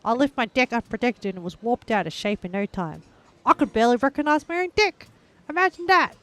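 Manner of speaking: reading the ad in a mock New Zealand accent; hammed-up Kiwi ad voice, deck said like dick. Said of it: "The, the joke is in the New Zealand a-, accent".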